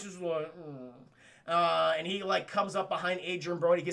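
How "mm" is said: low, very soft dopey hum; slack-faced little moan, played for laughs; derpy mock grunt. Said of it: "got that derpy face".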